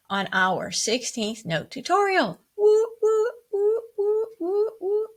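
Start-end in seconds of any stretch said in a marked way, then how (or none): 2.6-5.1 high-pitched cheering woos; celebratory woo. Real, not acted. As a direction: cheer the class on with high whoops. Cheering at end of tutorial.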